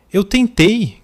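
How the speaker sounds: high loud shout back. A narrator's reading. Son yelling back at his father. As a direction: finally let the anger burst out. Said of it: "gritei de volta".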